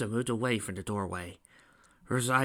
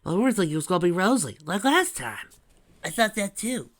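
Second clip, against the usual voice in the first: higher, quick character voice; lightly voiced chatty woman, pitched up; chatty female character; 10 semitones higher, 7 dB louder than usual. A narrator's reading. Patricia explains the change of plan, chatty. Patricia's excited dialogue in story.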